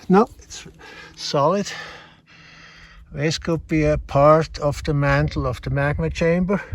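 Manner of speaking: ordinary talk, nothing marked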